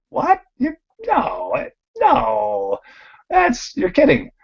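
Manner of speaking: retold shock, voice jumping way up, stammering; astonished disbelief. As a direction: squeak out your disbelief at the news. Retold shock at being top dealer.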